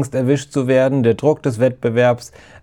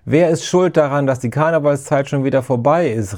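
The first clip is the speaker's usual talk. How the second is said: usual speaking voice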